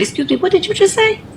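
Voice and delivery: high, sharp disbelief; incredulous challenge. Retold or imitated. Aide confronting the girl, high and incredulous. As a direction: ask it high, daring her to repeat it. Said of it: "I said".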